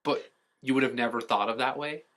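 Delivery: normal talking voice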